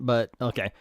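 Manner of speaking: plain reading voice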